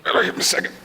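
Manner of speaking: lightly acted groggy wake-up, with groans; roused Yeshua. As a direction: grumble like someone just woken. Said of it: "I can just imagine Yeshua going".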